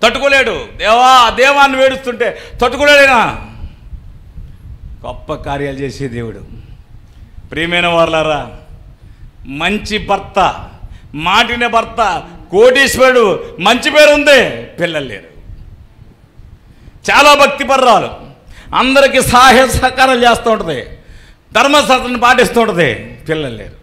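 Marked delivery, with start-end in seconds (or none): 0.0-3.5 raised, high, forceful preaching; full-force sermon voice; fervent preaching. Acted. 12.5-22.9 raised, high, forceful preaching; full-force sermon voice; fervent preaching. Acted. Preacher describes Hannah fervently.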